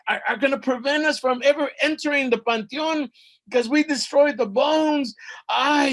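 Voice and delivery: high, loud panicked rant; freaking out. A narrator's reading. Hero seeing bones ground up, freaking out. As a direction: shout in mounting panic, stumbling over words. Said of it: "freaks out".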